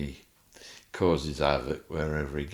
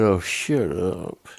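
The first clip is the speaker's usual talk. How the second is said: exasperated; 7 dB louder, much slower than usual. Retold or imitated. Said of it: "And I'm going".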